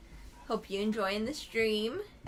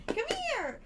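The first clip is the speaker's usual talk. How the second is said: talking to a dog; 13 semitones higher, 5 dB quieter, much faster than usual. Real, not acted. Calling the dog.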